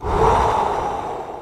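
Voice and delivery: long, slow exhale to settle panic; calming breath. Retold or imitated. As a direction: let out one long, soft breath.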